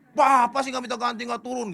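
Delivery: put-on high, loud scolding boy; Kristo memanas-manasi. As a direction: needle him loudly in a high voice. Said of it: "manas-manasin lagi dia". (meniru anak menyalahkan dengan suara tinggi)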